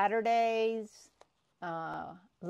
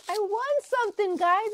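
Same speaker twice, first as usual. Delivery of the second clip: much higher and louder, squealing; excited squeal; 12 semitones higher, 6 dB louder than usual. Real, not acted. Vlogger telling viewers she won, squealing excitedly. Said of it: "I'm so excited".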